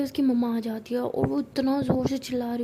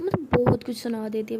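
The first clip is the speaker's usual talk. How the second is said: ordinary delivery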